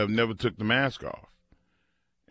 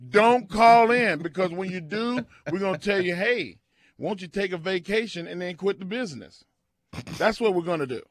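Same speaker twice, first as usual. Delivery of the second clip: hammed-up angry rant; mock rant; 6 semitones higher than usual. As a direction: rant at callers like a grumpy host. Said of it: "I'm mad".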